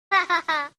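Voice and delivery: high, short doll cackle; creepy doll laugh. Acted. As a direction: giggle like a toy doll.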